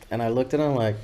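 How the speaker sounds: ordinary delivery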